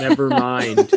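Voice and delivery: mock echo. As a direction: tease it back with a lifted Never mind.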